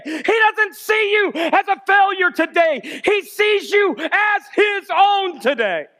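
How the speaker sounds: high, ringing, drawn-out preacher's cry; full-force preaching. Real, not acted. Preacher telling church how God sees them now, full-force preaching. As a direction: build to a shout, stretching the key words.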